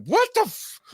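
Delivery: very high, cut-off exclamation; retold shocked outcry. Man retelling his reaction to the gunshot, shocked. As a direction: yelp it high and break off.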